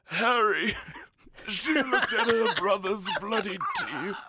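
high, theatrical villain voice, laughing; Lord Voldemort voice. Shown as a demonstration. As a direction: go bigger, hammy dark-lord recitation. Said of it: "I could go a little more".

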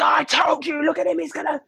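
mimicking the ex's telling-off, mocking and pitched high; mock angry ex. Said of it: "he had such a go at me".

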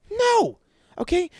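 high, loud "No"; shouted no. Real, not acted. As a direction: bark the answer out. Answering his own rant question.

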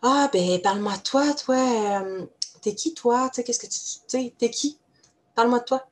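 nosy small-talk asker. Retold or imitated. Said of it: "on va demander aux gens".

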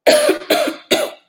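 loud harsh cough; real coughing fit. Real, not acted. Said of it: "had an actual cough".

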